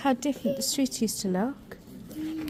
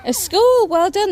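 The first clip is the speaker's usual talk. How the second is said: excited praise; 11 semitones higher, 8 dB louder, slower than usual. Real, not acted. Praising child's answer.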